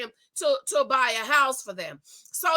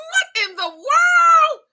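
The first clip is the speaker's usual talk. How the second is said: much higher, loud, slow outraged cry; shocked shriek; 9 dB louder, slower than usual. Retold or imitated. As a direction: shriek in disbelief. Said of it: "I'm like".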